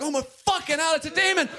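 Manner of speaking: high, loud mock panic at a demon; mock terror. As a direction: shriek in hammed-up horror. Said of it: "I'm like".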